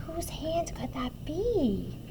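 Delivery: slow, suspenseful whisper; soft, drawn-out hush; hushed wondering. Acted. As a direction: whisper it like a mystery. Suspenseful question to young listeners.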